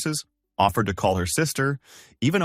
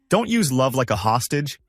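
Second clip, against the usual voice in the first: usual speaking voice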